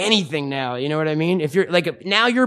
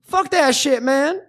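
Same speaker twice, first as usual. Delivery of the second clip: high, put-on voice ranting about wizards; mock his friend's stoned rant in a squeaky voice; impression of stoned Jesse; 7 semitones higher, slower than usual. Host mocking his stoned cohost's film snobbery, high put-on rant. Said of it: "you do a wonderful impression of me".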